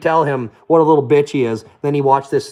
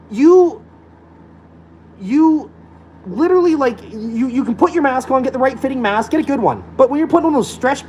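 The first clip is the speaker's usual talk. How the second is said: pitched-up frustration at mask wearers; heated rant; 9 semitones higher than usual. Real, not acted. Ranting about masks, voice climbing in exasperation.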